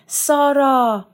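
loud, high, drawn-out cry; shouting. A narrator's reading. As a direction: call desperately for a missing sister. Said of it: "فریاد کشید". (فریاد بلند و کشیده)

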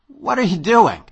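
loud startled exclamation; exclaiming. A narrator's reading. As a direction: call it out in alarm. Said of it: "I exclaimed".

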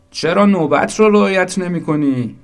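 high, loud outraged question; indignant protest. Acted. Someone in line protests the skipped turn, indignant. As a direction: protest loudly at a queue jumper.

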